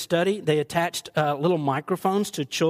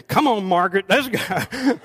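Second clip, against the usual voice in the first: mock guest leaving; 3 semitones higher, 5 dB louder than usual. Guest voiced urging to leave.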